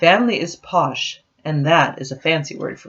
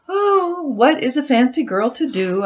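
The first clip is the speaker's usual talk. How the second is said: higher, hammed-up little-girl lament; mock dramatic sigh; 10 semitones higher than usual. Nancy asking her doll, higher mock-dramatic lament. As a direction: sigh it like a theatrical heroine.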